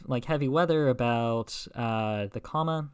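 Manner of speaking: normal talking voice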